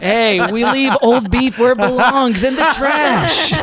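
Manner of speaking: mock stern scolding.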